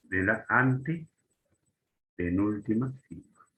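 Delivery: antepenúltima stretched out slowly in separate chunks; slow, deliberate. Shown as a demonstration. Teacher naming where esdrújulas take the accent, slow and deliberate. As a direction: draw out la, then pause inside antepenúltima. Teacher spells out the key term.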